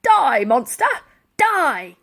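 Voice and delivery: loud angry battle yell. Acted. Buckshot yelling at the attacking Zeppelin, furious. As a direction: yell defiance at the airship. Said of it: "yelled Buckshot".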